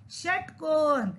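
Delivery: षटकोण slow, with the को drawn out; model pronunciation. Shown as a demonstration. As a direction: pronounce षटकोण deliberately, stretching the last syllable. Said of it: "एक और बार बोलिए".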